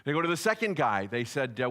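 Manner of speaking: usual speaking voice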